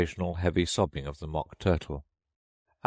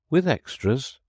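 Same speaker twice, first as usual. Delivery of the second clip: anxious; 9 semitones higher, 5 dB louder than usual. A narrator's reading. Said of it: "a little anxiously".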